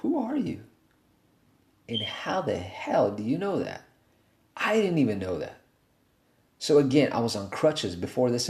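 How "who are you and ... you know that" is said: soft, hushed whisper of astonishment; awed whisper. Retold or imitated. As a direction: ask in a stunned hush. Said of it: "I look at this guy like".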